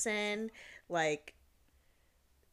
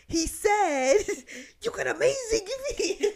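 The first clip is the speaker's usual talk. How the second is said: loud, high-pitched mock imitation of the stranger; hammed-up shout, imitating an admirer; exact mimicry; 12 semitones higher, 8 dB louder, slower than usual. Friend repeats what the stranger said, in loud high mimicry. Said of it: "said it exactly like him".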